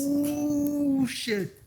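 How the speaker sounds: high gasping ooh of shock. Real, not acted. Host reacts to the insult.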